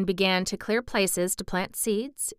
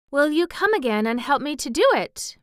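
put-on higher, louder girlish plea; begging; 7 semitones higher, 5 dB louder than usual. A narrator's reading. Mary asking him to come again, begging. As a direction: beg him eagerly. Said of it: "Mary begged".

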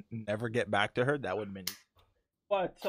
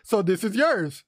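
Manta Ray voice; 5 semitones higher, 4 dB louder, slower than usual. Retold or imitated. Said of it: "That sounded like the altercation in SpongeBob".

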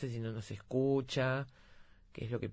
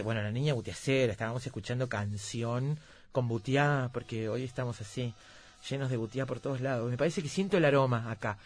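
ordinary delivery

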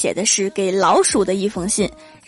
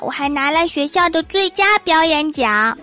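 put-on high, eager child's voice; excited child; 6 semitones higher than usual. A narrator's reading. Son bursting home to tell dad about his prize, excited high child voice. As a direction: bubble over like a proud kid. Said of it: "激动地跟郭大侠说".

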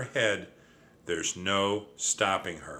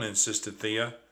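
usual speaking voice

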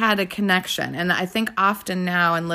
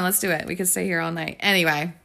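usual speaking voice

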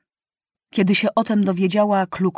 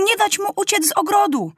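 high urgent command; shout; 12 semitones higher than usual. A narrator's reading. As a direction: keep the wolf in, cry it high. (Kluklu wysoko krzyczy do myśliwych)

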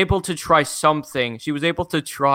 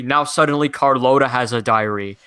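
ordinary talk, nothing marked

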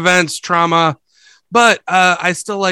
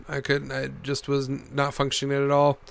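ordinary delivery

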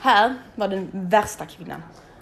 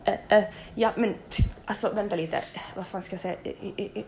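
stammering, losing the words, fading much softer; halting, stuttering speech; 6 dB quieter than usual. Retold or imitated. Patient who can't find her words, soft stammer. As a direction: search for words that won't come, trailing off quietly. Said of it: "jag pratade bokstavligen så här".

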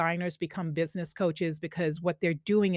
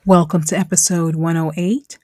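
loud, upbeat intro voice; podcast announcer; 8 dB louder than usual. Acted. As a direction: announce the show like a host. Podcast intro over music.

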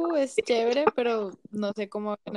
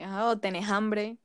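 ordinary talk

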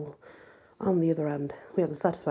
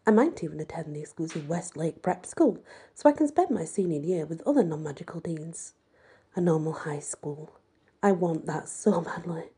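ordinary delivery

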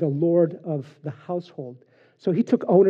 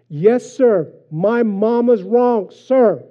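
loud, slow shout of obedience; shouted recruit reply; 5 semitones higher, 8 dB louder, much slower than usual. Retold or imitated. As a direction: bark it back at the sergeant. Recruit forced to shout back.

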